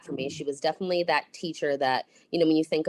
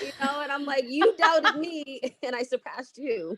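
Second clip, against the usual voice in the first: laughing; 9 semitones higher than usual. Real, not acted.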